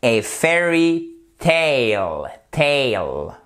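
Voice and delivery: slow "fairy tale", long AY in "tale"; careful model pronunciation. Shown as a demonstration. Teacher repeating for learners, "tale" drawn out with AY. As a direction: draw out "tale" with an AY as in "came". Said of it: "le A ici se prononce comme un A".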